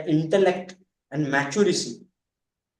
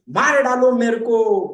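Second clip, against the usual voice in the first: put-on loud, slow, high drunken wail; drunken shout; 7 semitones higher, 7 dB louder than usual. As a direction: howl it from the road like a drunk making a scene. Drunk lying in street, shouting. (नशे में ज़ोर से चिल्लाना)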